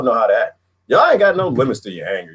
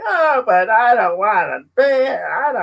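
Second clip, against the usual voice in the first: high, slow mocking whine; mock the guy who won't pay; a hint of a whining taxpayer; whiny complainer; 10 semitones higher, slower than usual.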